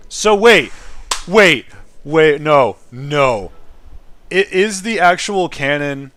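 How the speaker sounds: loud, sudden wait-no disbelief; loud disbelieving interruption; startled protest. Real, not acted. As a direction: stop everything, you just realized. Realizing the story's twist.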